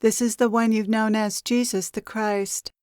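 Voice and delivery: normal talking voice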